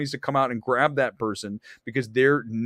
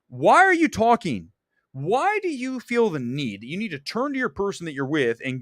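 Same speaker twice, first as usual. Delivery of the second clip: high-pitched, indignant demand; exasperated confrontation; 5 semitones higher than usual. Retold or imitated. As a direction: confront a chatterer in mock exasperation. Confronting movie talkers, acted out.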